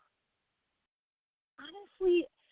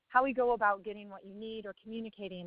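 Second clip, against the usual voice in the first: usual speaking voice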